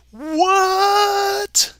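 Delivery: high drawn-out squeaky what. Retold or imitated. Reading chat aloud, a long high squeaky what. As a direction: squeak it in disbelief.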